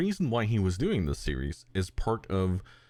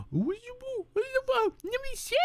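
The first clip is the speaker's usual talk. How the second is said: put-on very high, squeaky baby talk to a dog; cute dog voice. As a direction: coo it like talking to a puppy. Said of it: "the cute voice".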